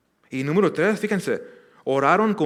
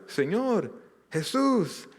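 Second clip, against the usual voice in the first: pitched high, a mock cry for help; imitating the blind men's high cry to Jesus; 8 semitones higher, much slower than usual. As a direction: call out to Jesus, much higher. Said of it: "Ellos más fuerte gritaban".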